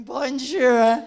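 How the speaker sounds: very loud, overdone French greeting; clumsy tourist French. Retold or imitated. Two non-speakers echoing the French girl, overloud clumsy greeting. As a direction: blare it out like a clueless foreigner. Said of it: "and we were going".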